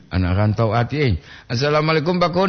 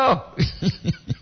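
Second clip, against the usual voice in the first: high, soft giggle; light chuckle; laughter; 4 semitones higher, 4 dB quieter than usual. Real, not acted. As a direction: let out a small giggle. Amused after exclaiming.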